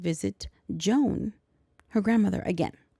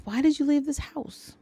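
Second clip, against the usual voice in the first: put-on child voice; 7 semitones higher than usual.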